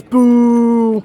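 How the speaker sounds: long, loud drawn-out boo; mock booing. Relaying Doc's heckle to co-host, drawn-out mock boo. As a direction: jeer with one sustained boo. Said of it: "Doc says".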